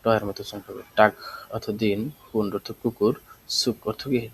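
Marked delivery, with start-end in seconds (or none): 1.0-1.1 টাক ending in a k sound; final g as k. Shown as a demonstration. Teacher showing learners that final g becomes k. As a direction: close টাক on k, not g. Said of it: "গেটা ক এর মতো উচ্চারণ করবে".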